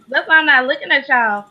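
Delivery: normal talking voice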